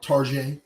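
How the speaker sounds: Tarjay said the French way, tar-ZHAY, stress on the end; mock French Target.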